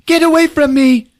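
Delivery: high-pitched panicky protest; mock frightened cry. Woman fending off Paul, mock frightened cry.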